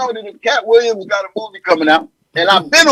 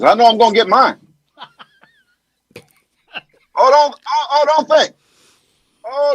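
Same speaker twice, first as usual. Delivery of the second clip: halting president, often higher-pitched; president impression; 4 semitones higher than usual. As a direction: stammer and pause, then insist like a politician. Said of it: "that president that we miss. Give us a little bit".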